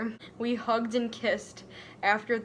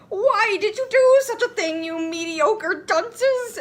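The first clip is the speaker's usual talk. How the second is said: much higher, loud, slowing scold; shrill McGonagall; 11 semitones higher, 8 dB louder than usual. Acted. McGonagall scolding the pair, loud high shrill voice. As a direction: squawk the scolding like a shocked teacher. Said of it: "asked Professor McGonagall".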